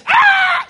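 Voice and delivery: high sharp surprised shout; man shouting in surprise at his birthday surprise; surprised cry. Retold or imitated. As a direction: yelp in delight at the reveal. Surprise party, eyes uncovered.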